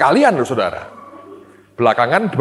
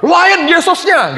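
much higher, louder and slow, every word hit; emphatic retort; 5 dB louder, slower than usual. Retold or imitated. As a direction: snap it out slow, high and loud. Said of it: "saya tidak berkata". (bantahan tegas: "Lain Yesusnya", tinggi, keras dan lambat)

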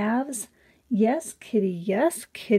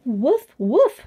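higher, louder playful woof; mock dog bark; 4 semitones higher, 6 dB louder than usual. Reading animal sounds to children.

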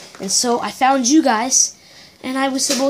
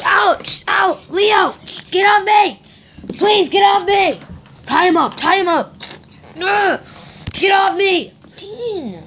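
high pained yells; shouting in pain; 10 semitones higher than usual. Acted. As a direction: yell while being grabbed. Being attacked and tied up.

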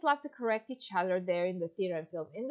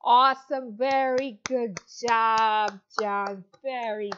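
put-on louder, bright teacher voice for a young child; demo kids' lesson; 4 dB louder than usual. Shown as a demonstration. Teacher to little John in China, upbeat and encouraging. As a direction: cheer on a five-year-old online student. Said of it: "we're gonna go on to our demo lesson".

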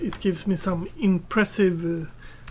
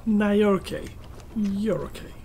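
normal talking voice

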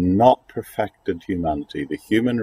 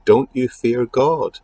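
mild rebuke; 6 semitones higher, slower than usual. Retold or imitated. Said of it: "the other criminal rebuked".

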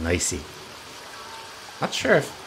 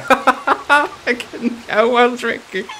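laughing; 6 dB louder, slower than usual. Real, not acted. Laughing at the cat drinking.

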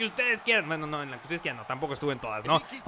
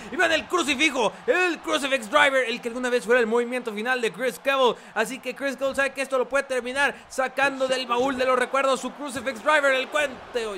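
wrestling commentator; 8 semitones higher than usual. Acted.

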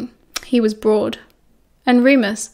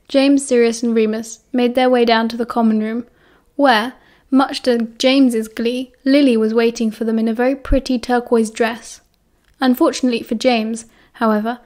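plain reading voice

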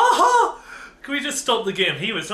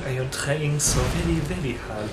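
lower, badly faked French accent; mock French accent; 5 semitones lower, 4 dB quieter than usual. Player told to go back to French, strained mock French accent. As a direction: plead effort in a put-on French accent. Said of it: "Go back to French".

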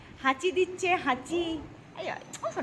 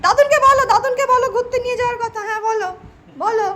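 higher, louder, quick coaxing; excited urging; 5 semitones higher, 6 dB louder, faster than usual. Real, not acted. Grandmother urging toddler to ask grandpa, excited coaxing.

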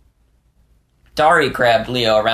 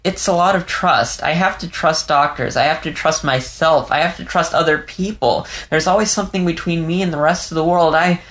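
put-on a young fox, voice pitched higher, on the edge of tears; Leo, shaken and tearful; 7 semitones higher than usual. A narrator's reading. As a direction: let Leo's words tremble, lost and confused. Leo's voice, opening up.

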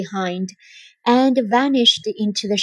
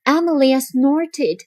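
ordinary delivery

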